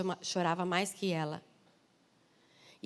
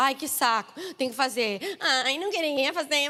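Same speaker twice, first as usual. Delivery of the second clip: whiny mock complaint, higher and louder; reclamando, esperneando; 4 semitones higher, 4 dB louder than usual. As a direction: whine like a reluctant child throwing a tantrum. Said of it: "aquele que reclama, esperneia, fala". (choramingando de má vontade, imitando)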